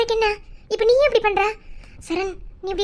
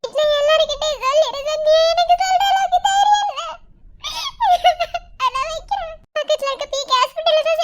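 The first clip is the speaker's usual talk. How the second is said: higher voice, breaking into sobs; tearful; 6 semitones higher than usual. Acted. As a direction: fight back tears while telling. Sister tearfully reports accident.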